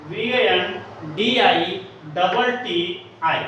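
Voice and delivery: slow, letter by letter; spelling out. Shown as a demonstration. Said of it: "बैंडिटी".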